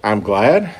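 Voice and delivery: puzzled "glad", lifting into a question; incredulous echo. Acted. Preacher playing a baffled disciple, incredulous. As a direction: echo it back as if it makes no sense. Repeats verse as baffled listener.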